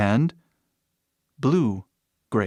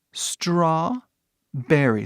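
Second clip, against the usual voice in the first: Strawberry stretched out very slowly; slow model word; 4 semitones higher, much slower than usual. Shown as a demonstration. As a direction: spell Strawberry out slowly for learners. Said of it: "Ou seja, morango".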